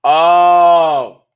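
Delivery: realizing. Real, not acted. Sudden remembering.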